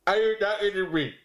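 a stronger mock movie gangster; Robert De Niro. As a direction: push into a different voice, squaring up. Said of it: "It's really easy".

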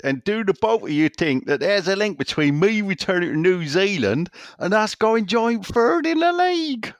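high, exaggerated West Country voice; Doddsy's Somerset accent. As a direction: ham it up in broad Somerset. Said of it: "he goes on to finish".

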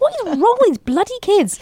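high-pitched mock exasperation; despairing retold outburst, pitch up; exasperated parent. Mum retelling her reaction to her children, exasperated.

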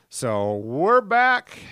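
big slow radio announcer. Pretend. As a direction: announce the return slowly and grandly. Grand show comeback announcement.

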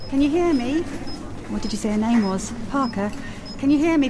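urgent calling. Acted. Calling to unresponsive Parker to hear me, urgent. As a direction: call out urgently, pitched well up.

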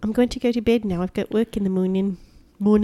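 quietly put-on New Zealand accent. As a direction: imitate a New Zealander, squeezing "morning" toward "moonin". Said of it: "the only thing you know how to say".

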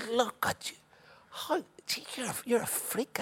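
high-pitched mock amazement; taunting trash talk. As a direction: gawp at a freakish giant, high and mocking. Retold trash talk to opponent.

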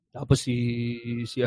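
ordinary talk, nothing marked

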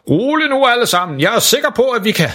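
put-on unhurried six-hundred-year-old Santa; Santa Claus voice. Santa calms panicking crowd.

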